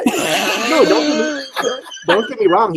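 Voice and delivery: protesting through laughter; laughing. Real, not acted. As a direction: laugh out the words, pitch way up.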